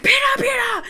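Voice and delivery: high, urgent mock yell; panicked calling out. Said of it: "she fucking freaks out".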